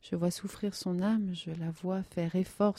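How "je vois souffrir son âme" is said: ordinary delivery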